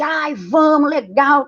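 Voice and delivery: high, shouted eager yes; eager reply. Retold or imitated. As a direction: jump at the idea, hyped. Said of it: "aí você". (resposta animada e gritada)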